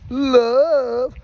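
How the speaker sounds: high, loud punchy peace; hyped game call. Shown as a demonstration. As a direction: sell the word like a game host. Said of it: "and you go".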